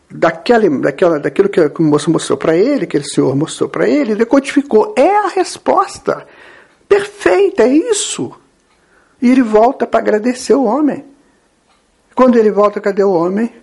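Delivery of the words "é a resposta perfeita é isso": very high, drawn-out eureka; excited realization. Acted. Young man decoding the stranger's message, excited realization. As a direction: exclaim it as the answer dawns. Acted moment the answer is decoded.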